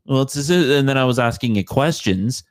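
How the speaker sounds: ordinary talk, nothing marked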